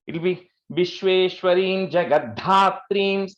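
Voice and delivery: slow, Jagadhatrim with its dha stressed; slow down and punch the dha in Jagadhatrim; emphasis on dha. Shown as a demonstration. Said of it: "second Dha gets emphasis there".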